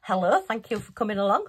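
ordinary delivery

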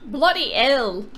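drawn-out mock British; British accent. Offering the one British phrase they know, slow British accent. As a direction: stretch out a stock British exclamation, in British accent. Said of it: "That's all I know".

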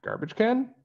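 very high rising question; puzzled echo. Acted. Skaters puzzled by the girls' mention, surprised. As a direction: echo it back, baffled.

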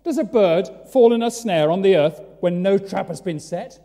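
loud, high open-air question; preacher's shout. Retold or imitated. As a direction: call it out to the crowd. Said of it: "So Amos goes on".

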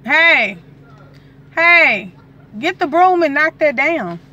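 yelling across the room, high and loud; real shout. Real, not acted. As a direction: shout at him to grab the broom now. Yelling at husband over spider.

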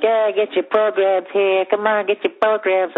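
high, drawn-out vendor's hawking call; program seller's cry. Retold or imitated. Program lady hawking to the crowd outside the stadium, drawn-out high call. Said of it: "This lady's selling programs".

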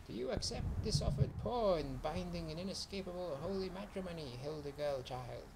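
officiate like a sly weasel; sly hissing weasel. A narrator's reading. Weasel priest conducting the wedding.

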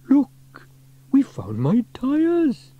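loud, high, drawn-out discovery; excited exclamation. A narrator's reading. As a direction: call out a happy find, slowly and high. Character spots his lost tyres.

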